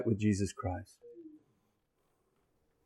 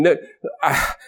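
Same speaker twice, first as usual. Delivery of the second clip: ordinary talk, nothing marked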